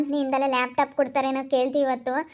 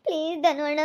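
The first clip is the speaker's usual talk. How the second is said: acted crying, begging between sobs; tearful pleading; 3 semitones higher than usual. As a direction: plead through tears, fading at the end. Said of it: "ಕಣ್ಣೀರ್ ಹಾಕ್ಬೇಡ". (ಅಳುತ್ತಾ ಅಂಗಲಾಚುವ ಧ್ವನಿ)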